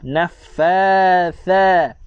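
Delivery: "Naffatha" drawn out slowly, tongue out on tha; slow correct tha. Shown as a demonstration. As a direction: stretch "Naffatha", tongue between teeth for tha. (sebut "Naffatha" perlahan dengan lidah keluar)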